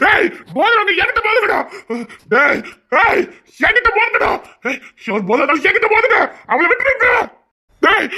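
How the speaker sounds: high, shouted dare to the demons; father shouting at the demons to take him instead; defiant challenge. Acted. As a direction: call them out to fight.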